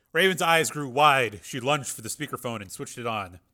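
usual speaking voice

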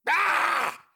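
high, strained yell as if struck; cry of pain. A narrator's reading. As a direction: cry out at a sudden jolt. Cuffs slam prisoner double.

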